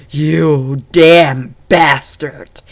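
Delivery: loud, slow venomous curse; furious slow snarl at the enemy; disgusted snarl. Acted. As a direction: drag out the insult with hatred. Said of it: "he said in a disgusting voice".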